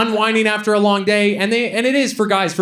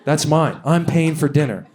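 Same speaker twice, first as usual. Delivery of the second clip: usual speaking voice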